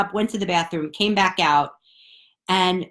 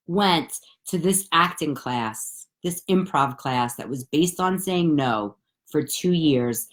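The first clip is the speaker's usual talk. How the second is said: normal talking voice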